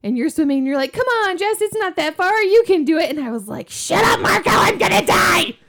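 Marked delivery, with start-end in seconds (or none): 0.9-3.1 cheering on. Retold or imitated. Husband cheering from kayak. 3.7-5.6 high, loud shout; mock desperate yell. Exhausted swimmer yelling at husband cheering from his kayak, hammed-up shout.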